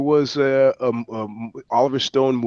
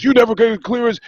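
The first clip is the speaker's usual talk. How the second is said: higher mock angry outburst; photographer flipping out; 9 semitones higher than usual. Guest imitating photographer flipping out over money, mild mock anger. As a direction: snap out the complaint, mildly mock furious. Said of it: "he flipped out".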